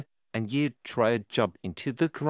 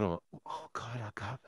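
despairing mutter; 12 dB quieter than usual. Acted. Panicking over crying kid.

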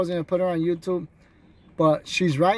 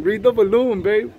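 usual speaking voice

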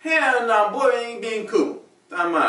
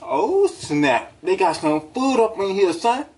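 usual speaking voice

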